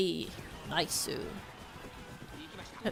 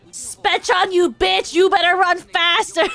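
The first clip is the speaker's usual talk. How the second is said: higher, louder mock yell; retold yell; 7 semitones higher, 8 dB louder than usual. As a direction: yell at her to run faster. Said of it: "I literally called her, I said".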